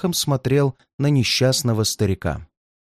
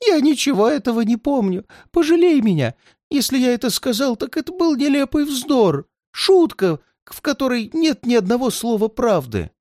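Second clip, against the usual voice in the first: much higher, begging voice; pleading old man; 11 semitones higher than usual. A narrator's reading. Old man begs the redhead to pity him, high pleading.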